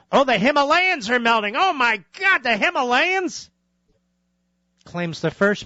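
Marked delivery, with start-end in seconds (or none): 0.1-3.5 much higher, hammed-up alarm; mock panic. Host mocking alarm over melting glaciers, mock panic. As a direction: parody a hysterical alarmist.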